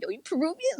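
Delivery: high, surprised question. Retold or imitated. Imitating herself meeting another Afro-Peruvian, surprised. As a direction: act amazed to meet one.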